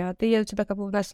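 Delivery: normal talking voice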